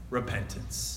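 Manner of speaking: quiet emphatic answer; hushed key word. Real, not acted. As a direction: drop the voice to land it. Soft punch word.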